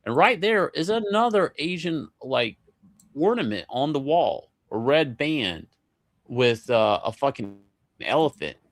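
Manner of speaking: ordinary talk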